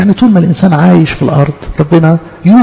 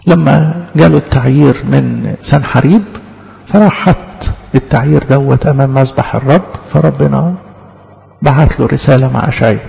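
usual speaking voice

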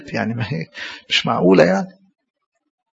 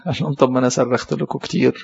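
ordinary talk, nothing marked

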